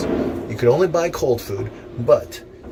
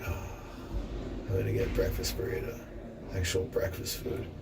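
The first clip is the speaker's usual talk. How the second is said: hushed; 10 dB quieter than usual. Retold or imitated. Quoting himself, hushed.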